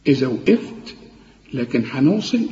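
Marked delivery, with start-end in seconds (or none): none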